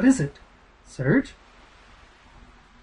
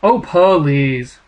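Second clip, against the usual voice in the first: usual speaking voice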